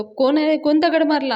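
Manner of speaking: loud angry call at the door; angry shout. Acted. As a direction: yell out at whoever threw the stone. Datta shouting at stone thrower.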